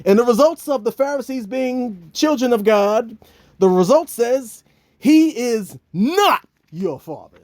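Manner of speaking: slow, dramatic announcer build; talk-show results reveal. Retold or imitated. Host reading paternity results to the Pharisees, slow and grand. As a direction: stretch out the big reveal. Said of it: "He's like".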